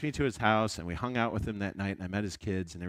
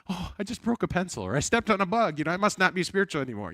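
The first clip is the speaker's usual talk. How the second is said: high, fretful mock panic over nothing; hammed-up anxious believer, much higher; 11 semitones higher, 4 dB louder than usual. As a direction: squeal it like a tiny slip means damnation. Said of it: "we're like".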